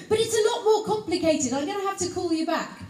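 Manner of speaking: high, loud mock-irritated agent; rather crossly. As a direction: carry on as the cross agent, hurrying at the end. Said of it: "she said rather crossly".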